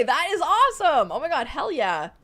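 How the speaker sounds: loud, high burst easing slightly; thrilled, emphatic approval; excited cheer. Real, not acted. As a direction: cheer on their plan. Cheering viewer's plan to play Hades.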